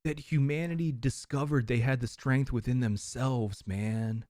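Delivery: soft, hushed mock-profound guru; like a dreamy beatnik sage, lightly. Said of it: "are you a beatnik or Deepak Chopra?".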